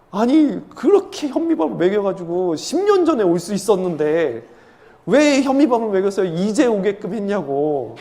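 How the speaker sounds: comic indignant complaint, hammed up; mock scolding. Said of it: "할머니한테 타박을 줬다는 거죠".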